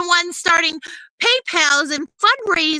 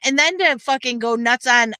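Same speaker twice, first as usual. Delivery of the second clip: ordinary delivery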